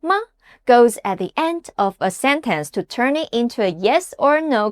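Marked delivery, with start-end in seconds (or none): 0.0-0.2 "Ma" short and unstressed, with no tone movement; neutral tone. Shown as a demonstration. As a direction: say "Ma" as a brief toneless syllable. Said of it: "This tone has no pitch".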